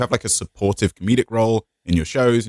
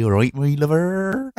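slow, slurred West Country farmer; drawn-out rural English, thick slurred R's; sleepy West Country; 5 semitones higher, slower than usual. Shown as a demonstration. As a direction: draw it out in a sleepy countryside accent. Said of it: "I'll get a little bit sleepy".

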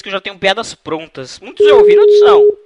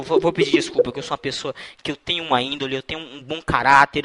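usual speaking voice

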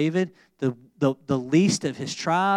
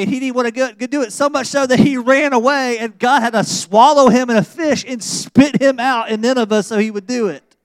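fired-up preaching; 8 semitones higher, 8 dB louder than usual. Acted. Preaching at full force.

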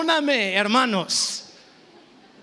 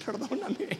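sheepish laugh; 5 dB quieter than usual. Real, not acted. Confessing fight, embarrassed.

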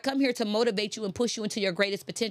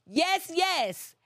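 rousing yes; 8 semitones higher, much slower than usual. Acted. Preaching climax, high exclaimed yeses.

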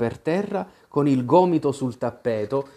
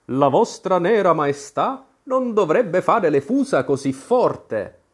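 respectful but stern; 4 semitones higher than usual. A narrator's reading. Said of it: "in tono rispettoso, ma con una certa severità".

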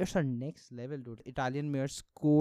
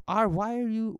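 louder, much higher outburst; mock angry mayor; 10 semitones higher, 7 dB louder than usual. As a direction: burst out at the citizens. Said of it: "one of the mayors was like".

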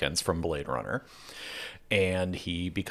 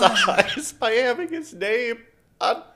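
laughing; 6 dB louder than usual. Real, not acted. Laughing, mocking the film.

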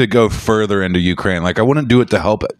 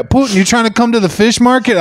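high, quick British-Australian muddle; bad British accent; 11 semitones higher, faster than usual. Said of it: "I guess that's my British accent".

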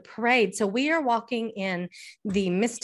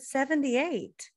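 dad's answer; 5 semitones higher, much slower than usual. Retold or imitated. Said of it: "He said".

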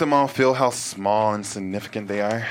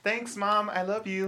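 lightly voiced young kid, soft; a hint of a sweet child; 10 semitones higher, 5 dB quieter than usual. Child thanking mom at school, soft kid voice.

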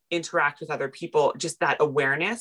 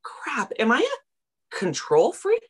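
realization; 8 semitones higher, slower than usual. Retold or imitated. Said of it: "looking at the sky going".

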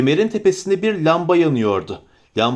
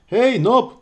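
calling out; 7 semitones higher than usual. Acted. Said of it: "diye bağırdı".

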